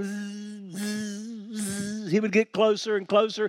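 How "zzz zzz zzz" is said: soft, steady buzzing noise; hushed, raised-pitch bee hum; imitated bee buzz. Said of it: "He was just buzzing".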